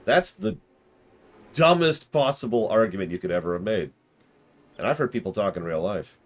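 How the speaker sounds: ordinary delivery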